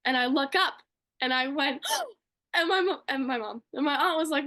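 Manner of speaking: usual speaking voice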